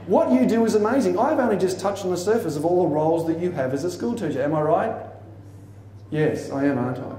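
usual speaking voice

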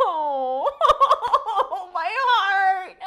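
high, loud laughing swoon; touched laughter. Real, not acted. Viewer melting at a sweet scene, high tearful laughing.

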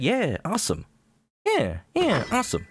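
half-hearted crowd reaction; imitated crowd. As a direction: lightly imitate a background crowd saying it. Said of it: "hear the crowd in the background saying".

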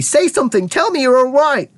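high, desperate plea; anxious cry. A narrator's reading. Mother begging her tiny son for reassurance, crying out. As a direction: beg in a high voice. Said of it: "cried Missus T V".

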